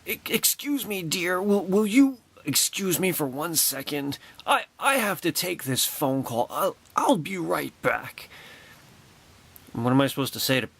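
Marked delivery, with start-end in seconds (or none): 0.1-8.3 lightly voiced, higher, slow polite apology; a hint of a gracious star excusing herself; imitating Carrie Fisher. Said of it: "and she's like".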